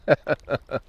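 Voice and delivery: short "heh-heh-heh" laugh; amused chuckle. Real, not acted. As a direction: chuckle briefly to yourself. Amused after releasing the fish.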